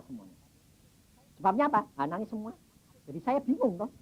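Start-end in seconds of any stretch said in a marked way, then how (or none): none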